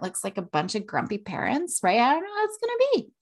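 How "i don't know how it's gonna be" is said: much higher and fast, anxious "I don't know how it's gonna be"; mock nervous. Shown as a demonstration. Mom showing her daughter how nerves sound, high and rushed. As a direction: rush it up high like stage fright. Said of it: "You pay attention".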